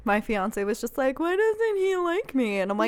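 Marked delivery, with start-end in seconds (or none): 1.2-2.6 high, mock-wounded complaint; pitched-up pretend hurt; hurt whine. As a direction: whine it like someone snubbed, imitating. Fiance hurt by cat's rejection.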